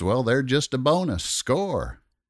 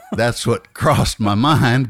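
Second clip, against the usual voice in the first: ordinary delivery, nothing marked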